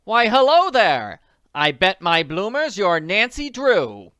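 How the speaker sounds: high, loud, slow chirpy greeting; Jane Willoughby. Retold or imitated. Greets Nancy Drew as game character, high chirpy voice. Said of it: "impersonation of Jane Willoughby".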